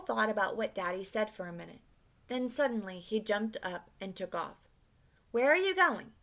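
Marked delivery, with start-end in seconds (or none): 5.3-6.1 put-on high, louder call after a running boy; yelling after him. A narrator's reading. Father calling after his son as he runs off. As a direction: call out across the yard. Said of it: "Daddy yelled after him".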